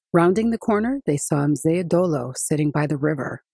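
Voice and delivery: ordinary delivery, nothing marked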